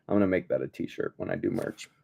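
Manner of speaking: low, soft, fast aside; quiet aside. Real, not acted. Vlogger aside about merch, low quick mumble. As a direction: toss it off under your breath.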